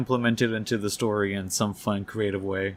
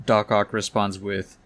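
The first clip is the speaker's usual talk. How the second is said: ordinary delivery, nothing marked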